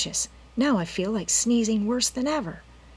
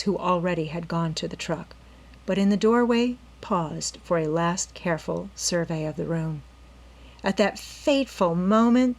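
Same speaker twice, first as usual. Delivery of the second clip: ordinary delivery, nothing marked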